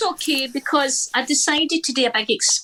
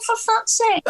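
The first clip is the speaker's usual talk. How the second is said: exasperated; 13 semitones higher, slower than usual. Retold or imitated. Exasperated retort to family.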